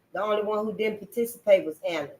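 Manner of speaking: ordinary delivery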